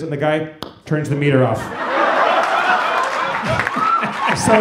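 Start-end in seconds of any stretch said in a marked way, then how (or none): 1.6-4.3 long, high breathless laugh. Real, not acted. At the cab-driver punchline, a high breathless laugh. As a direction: burst into high silent laughter.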